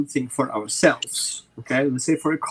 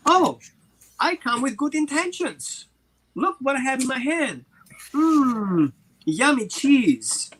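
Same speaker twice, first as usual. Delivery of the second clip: higher, sweet coaxing voice, slowing on the treat; coaxing owner; 7 semitones higher, slower than usual. Retold or imitated. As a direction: lure the dog in gently.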